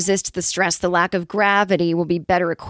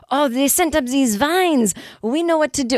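lightly voiced French alien, high; a French alien shrugging off the vines, hammed up; mock French accent; 6 semitones higher than usual. Podcast host voices a French alien receiving vines, mock French accent. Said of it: "they're like French aliens".

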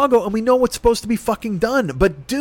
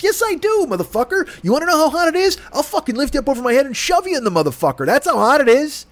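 higher, heated tirade with swearing; angry outburst, pitched up; furious rant; 4 semitones higher than usual. Acted. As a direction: vent at him like he's standing there. Furious rant at absent manager.

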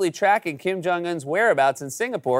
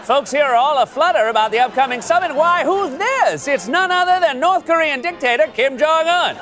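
put-on high, loud old-timey newsreel narrator; hammy vintage newsreel voice; old radio announcer; 7 semitones higher, 3 dB louder than usual. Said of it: "Why not just make an old-timey newsreel?".

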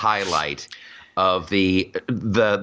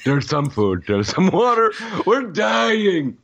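a hint of the whiny cartoon king; rising to a whiny cry; lightly voiced mock mayor; 6 semitones higher, slower than usual. Mock mayor finds food behind her, then whines. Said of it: "I'm King Julian".

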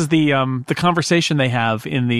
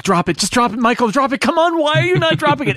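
pleading viewer; 11 semitones higher than usual. Retold or imitated. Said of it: "I'm like".

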